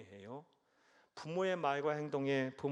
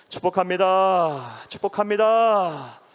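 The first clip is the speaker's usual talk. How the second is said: slow, much louder and higher repeated blessing; 5 semitones higher, 18 dB louder, slower than usual. Real, not acted. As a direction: call out the blessing slowly at full voice. (우렁차고 느린 축복 선포)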